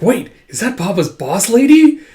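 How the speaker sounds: high, loud question climbing at the end; childlike surprise. Retold or imitated. Child spotting her dad's boss, childlike surprise. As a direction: voice a startled little kid.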